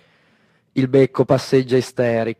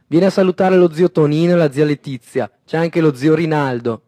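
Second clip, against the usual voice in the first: mother calling out; 5 semitones higher, 6 dB louder than usual. A narrator's reading. Mother calling Mario over.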